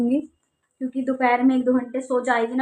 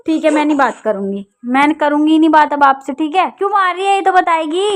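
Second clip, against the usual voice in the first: loud, higher exasperated demands; scolding; 4 semitones higher, 8 dB louder than usual. Real, not acted. Mother scolds child hitting her. (झुँझलाकर ज़ोर से डाँटना)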